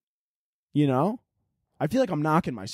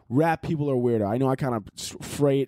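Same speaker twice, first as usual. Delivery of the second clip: ordinary talk, nothing marked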